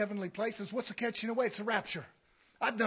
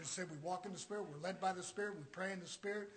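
ordinary talk, nothing marked

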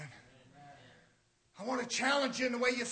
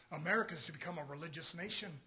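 ordinary delivery

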